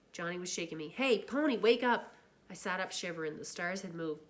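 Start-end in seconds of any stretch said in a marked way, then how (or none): 0.9-2.1 urgent wake-up call. Acted. Waking a sleeping friend.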